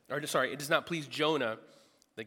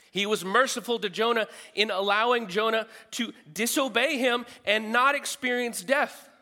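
louder, higher and building with each repeated line; rising pulpit fervour, louder each time; full-force preaching; 7 semitones higher, 7 dB louder than usual. Acted. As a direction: preach it, climbing toward the peak.

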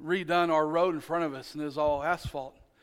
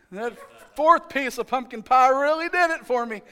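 higher and louder, hamming up being too full; mock overstuffed groan; 7 semitones higher, 7 dB louder than usual. Preacher joking about himself after Thanksgiving dinner, hammed-up stuffed voice. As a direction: play the overfed uncle for laughs. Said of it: "that's me after Thanksgiving meal".

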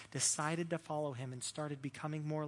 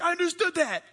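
much higher, louder mocking voice; mock dialect voice; 14 semitones higher, 11 dB louder than usual. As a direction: squeal it out as a caricature of someone's dialect. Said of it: "That's somebody's dialect".

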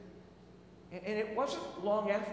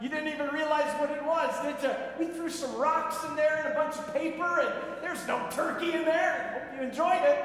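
higher, louder smug gloating; gloating taunt; 6 semitones higher, 4 dB louder than usual. Retold or imitated. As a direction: rub the prank in his face. Coworkers reveal prank.

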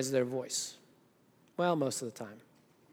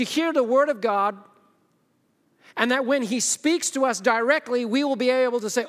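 raised preacher's voice, higher and driving; forceful preaching; 7 semitones higher, 6 dB louder than usual. Real, not acted. Preacher explaining why we read the Bible, forceful. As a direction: press the point on the congregation.

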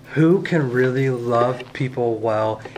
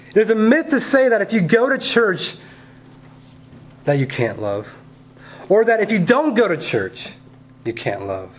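higher, fervent preacher voice; preaching; 7 semitones higher than usual. Acted. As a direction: preach it with rising passion.

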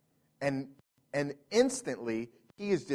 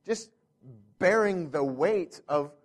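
usual speaking voice

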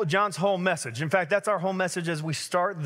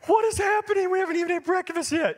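incredulous shock; 13 semitones higher than usual. Retold or imitated. Friend baptizing kids before breakfast, incredulous high outburst. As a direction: squeak out disbelief at the scene.

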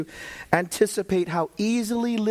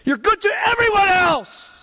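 complaining to God; 13 semitones higher, 8 dB louder than usual. Acted. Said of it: "Or you doubt his goodness".

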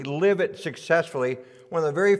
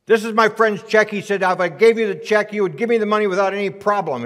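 loud, indignant customer demand; higher, louder mock indignation; angry raised voice; 5 semitones higher, 7 dB louder than usual. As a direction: push it out, fed up and loud. Frothing customer at new bank.

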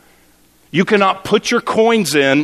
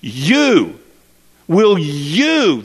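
"you" drawn out and swelling, like all caps; stress on YOU; 2 semitones higher, much slower than usual. Shown as a demonstration. Preacher puts the verse's one word in capitals, stressing "you". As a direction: set "you" apart and lean heavily on it. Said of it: "If there was an all caps word".

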